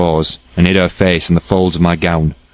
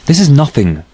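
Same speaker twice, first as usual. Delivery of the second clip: a higher, sudden cry of protest; cried out; 7 semitones higher than usual. A narrator's reading. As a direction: burst out, brushing it aside. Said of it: "cried she".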